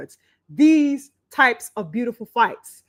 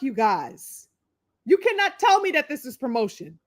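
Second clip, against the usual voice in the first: usual speaking voice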